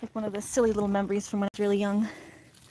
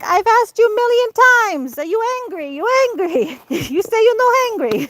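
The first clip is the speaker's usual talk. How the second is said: much higher, louder exasperated nana in broken Italian English; little Italian fit; 11 semitones higher, 8 dB louder than usual. Retold or imitated. Grandmother scolding the hungry child, a high loud Italian fit. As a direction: throw a mock Italian tantrum. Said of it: "a little Italian fit".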